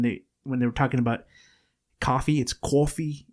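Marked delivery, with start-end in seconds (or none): 2.0-3.2 put-on New York accent. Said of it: "they would always say stuff like".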